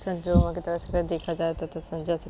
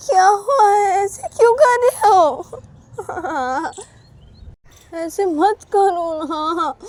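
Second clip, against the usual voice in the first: high, loud wail with drawn-out words; pleading Uma; 12 semitones higher, 9 dB louder, slower than usual. Acted. Uma begging Sanju to stop staring, high and wailing. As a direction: beg in a high, whiny cry.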